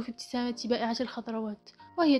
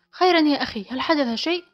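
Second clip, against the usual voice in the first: louder, slightly raised anxious questioning; worried; 4 semitones higher, 8 dB louder than usual. A narrator's reading. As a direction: ask with sudden concern, as if fearing bad news. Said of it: "رددت عليه بقلق". (سؤال بقلق)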